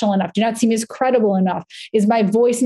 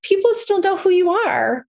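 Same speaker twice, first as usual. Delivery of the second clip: husband's reminder; 9 semitones higher than usual. Retold or imitated. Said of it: "Adam would be like".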